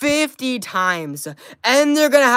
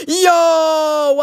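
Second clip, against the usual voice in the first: excited shout; 8 semitones higher, 5 dB louder than usual. Real, not acted. Hype shoutout for a donation.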